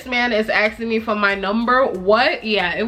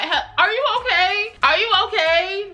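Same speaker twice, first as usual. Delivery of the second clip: mock disbelief; 11 semitones higher than usual. Mocking man asking her.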